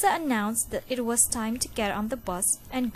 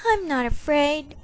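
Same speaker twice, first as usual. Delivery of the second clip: louder, higher firm self-talk; boy telling himself firmly at bedtime; 5 semitones higher, 5 dB louder than usual. A narrator's reading. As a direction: talk yourself into it. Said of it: "told himself firmly".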